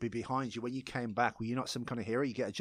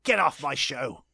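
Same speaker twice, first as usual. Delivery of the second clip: loud, high, hammed-up grilling; mock tirade; 8 semitones higher, 8 dB louder than usual. Host throwing the co-host off the show, mock tirade.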